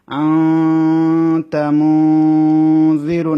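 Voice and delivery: আনতা মুনজিরু chanted slowly and loud, nun held in the nose for two alif; ikhfa nasal hold. Shown as a demonstration. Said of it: "নাকের মধ্যে নিয়ে দুই আলিফ সমান রাখতে হবে".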